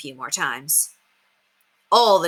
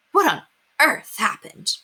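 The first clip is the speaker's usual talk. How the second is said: dismayed question; 6 semitones higher, slower than usual. Acted. Mother sees mess.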